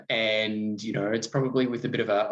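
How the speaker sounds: ordinary talk, nothing marked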